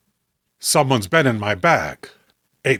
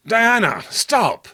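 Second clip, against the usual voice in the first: high urgent call to stop her; sharp high call; 7 semitones higher, slower than usual. Acted. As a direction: cry out her name in alarm. John calls out to stop Diana.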